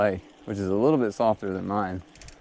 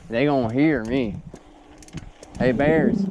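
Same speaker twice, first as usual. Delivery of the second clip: calling out louder to bears; scaring off a bear; 6 semitones higher, 4 dB louder than usual. Real, not acted. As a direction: raise the voice to warn bears. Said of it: "I'm making noises".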